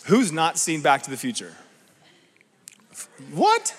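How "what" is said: a high, startled squeak of disbelief; surprised. Real, not acted. Preacher shocked some haven't seen the film, high surprised yelp. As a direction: act stunned they haven't seen it.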